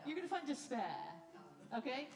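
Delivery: ordinary delivery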